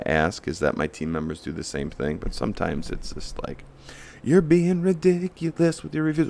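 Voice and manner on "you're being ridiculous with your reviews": whiny customer voice. Retold or imitated. Mocking a complaining customer.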